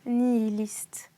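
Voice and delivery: slow, whispered "Nihilistes", every syllable separated; articulated distinctly. A narrator's reading. Nephew answering his uncle, spelling out the word slowly. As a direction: spell the word out slowly under your breath. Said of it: "articula distinctement Arcade".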